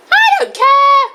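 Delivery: high-pitched defiant cartoon retort; high, stubborn little voice; Diddy Kong. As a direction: talk back in a high character voice. Diddy talks back to host.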